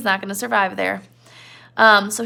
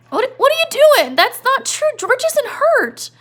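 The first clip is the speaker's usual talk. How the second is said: very high, louder, slow panicky denial; panicked Lenny; 7 dB louder than usual. Retold or imitated. Lenny panicking at Crooks's words, very high and loud. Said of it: "Lenny's like".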